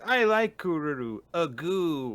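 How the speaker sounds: high, loud cutesy voice. Retold or imitated. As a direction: chirp it like a sweet girl. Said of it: "She specifically says".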